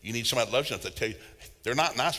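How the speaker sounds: ordinary talk, nothing marked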